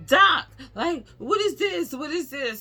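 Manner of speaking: high, loud anxious plea to the doctor; nervous. Retold or imitated. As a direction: burst in demanding answers, scared. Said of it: "So I was nervous".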